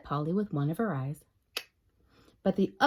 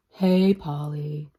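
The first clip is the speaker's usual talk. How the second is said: glum reply; 5 semitones lower, 5 dB louder, much slower than usual. Acted. Depressed spider replies.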